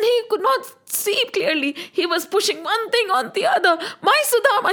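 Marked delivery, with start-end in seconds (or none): 4.0-4.7 high, mild acted cry of joy; storyteller's light shout; excited shouting. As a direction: call it out with raised pitch. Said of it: "he kept shouting".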